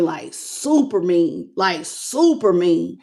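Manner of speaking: super mean drawn out slowly, weight on super; slow emphasis. Real, not acted. Reader dwells on the cruelty, saying super mean slowly. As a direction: lean on super and stretch it.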